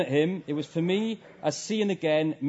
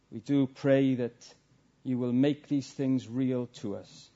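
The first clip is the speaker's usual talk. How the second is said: earnest prayer, a little lower in pitch; closing prayer; 3 semitones lower than usual. Real, not acted. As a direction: pray it humbly on behalf of the congregation.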